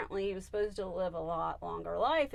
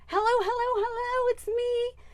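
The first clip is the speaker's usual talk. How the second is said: channeled pet; 8 dB louder than usual. Acted. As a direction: call out eagerly in a high pet voice. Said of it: "and he goes".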